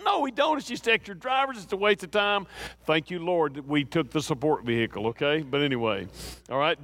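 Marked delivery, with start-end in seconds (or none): 0.0-2.5 imitating his stubborn younger self; mock dismissive retort. Retelling how he brushed off his wife, mock dismissive and higher. Arguing with wife about vehicle.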